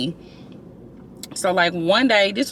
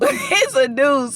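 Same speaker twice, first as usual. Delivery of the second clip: laughing; 6 semitones higher, slower than usual. Real, not acted. Laughs retelling the punchline.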